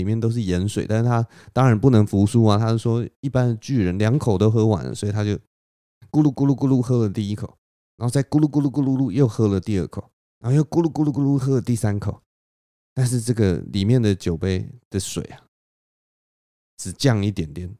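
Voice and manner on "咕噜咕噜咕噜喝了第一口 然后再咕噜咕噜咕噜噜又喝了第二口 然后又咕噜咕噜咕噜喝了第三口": gulping sounds. A narrator's reading. Thor gulping the salty drink.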